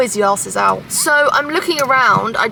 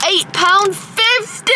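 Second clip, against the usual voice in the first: shocked at price; 8 semitones higher, slower than usual. Real, not acted. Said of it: "Eight pound fifty I spent on this".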